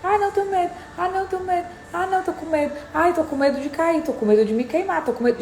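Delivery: high, whiny imitation of a scared person; mock fearful whine. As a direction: parody someone who keeps whining about fear. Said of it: "você fica declarando".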